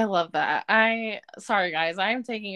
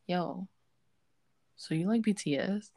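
whispered secret question; hushed classmate question; 4 dB quieter than usual. Retold or imitated. Classmate between classes whispering about BTS. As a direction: lean in and whisper it. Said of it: "people would be like".

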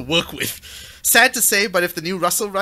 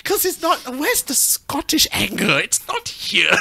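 mock outrage; 6 semitones higher, slower than usual. Mock outrage over missing Scottish anger.

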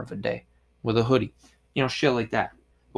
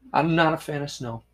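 ordinary talk, nothing marked

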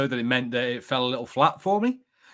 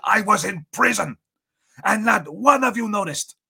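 put-on higher, louder Andor accent; Andor impression; 8 semitones higher, 5 dB louder than usual. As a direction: deliver it as Andor, indignant at being overlooked. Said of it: "it's my best impression of Andor".

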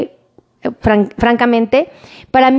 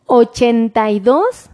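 dictating slowly; slower than usual. Shown as a demonstration. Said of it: "lo dicto más lento".

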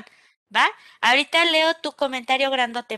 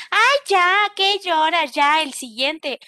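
higher, louder, brushing off someone's tears; dismissive scoff; 5 semitones higher, 6 dB louder, slower than usual. Retold or imitated. Teacher imitating a friend brushing off a breakup, a loud impatient scoff. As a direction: scoff at their heartbreak, impatient, then drag out the last bit slowly.

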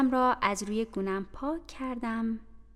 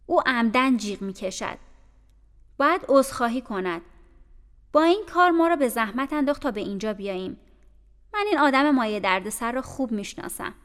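louder, contemptuous scolding; hateful tone; 7 dB louder than usual. Acted. Abbot complaining about Jane to Bessie, hateful louder scolding. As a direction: spit out disdain for the girl. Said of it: "با لحنی تنفرآمیز گفت".

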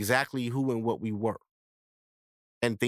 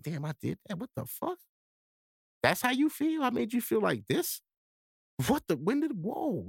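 acted stunned disbelief; 7 semitones higher than usual. Boyfriend realizing how he made his girlfriend feel, acted stunned. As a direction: replay a dawning, incredulous realization.

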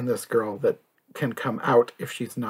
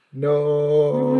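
long, sustained imitation of a character's "no"; comically drawn-out no; 3 semitones higher than usual. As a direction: stretch it out, hammed up for laughs. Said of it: "is hilarious is the way she says".

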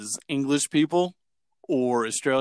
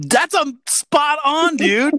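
high, drawn-out amazed approval; delighted praise; 13 semitones higher, 5 dB louder, slower than usual. Real, not acted. Praising the Bart Simpson impression, high and delighted. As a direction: squeal it, thrilled.

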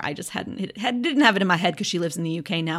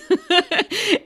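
laugh; 12 semitones higher, 6 dB louder than usual. Real, not acted. Laughing at own complaint.

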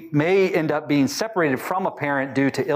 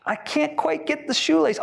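put-on child voice; 11 semitones higher than usual. Child voice in example.

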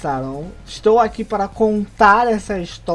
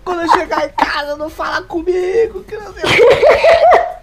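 high, tearful retold call to dad; crying to dad; 9 semitones higher, 6 dB louder than usual. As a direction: sob it out like a frazzled kid. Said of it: "eu liguei pro meu pai chorando".

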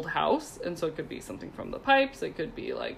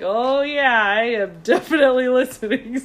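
amused reply; 5 semitones higher, 7 dB louder than usual. Retold or imitated. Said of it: "I'm like".

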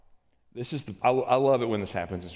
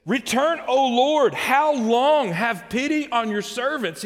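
loud, very high cry to God; pleading outburst; 13 semitones higher, 7 dB louder, slower than usual. Acted. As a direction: burst out begging the Lord for pity. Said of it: "Moses has a little outburst here".

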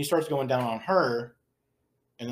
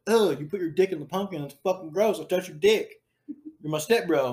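higher-pitched mock disgust; acting repulsed; 6 semitones higher than usual. Stepsister scolds stepbrother, imitated repulsion in a higher voice. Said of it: "stepsister is acting all repulsed".

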